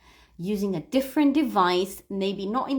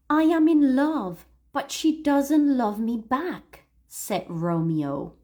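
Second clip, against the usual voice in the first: read-aloud model; 4 semitones higher than usual. Shown as a demonstration. Teacher voicing Romeo for students to repeat, emphatic model reading.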